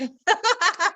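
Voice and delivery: short amused laugh; quick real laugh. Real, not acted. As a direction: laugh at the interruption. Laughs at interruption.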